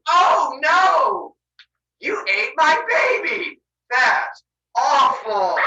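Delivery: loud, high, slow mock wail; fake horror. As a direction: ham up the grief, badly faked. Rhea fakes horror at eaten baby.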